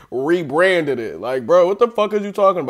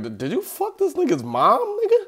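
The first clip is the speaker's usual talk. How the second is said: mocking voice; 13 semitones higher than usual. Mocking the question in put-on voice.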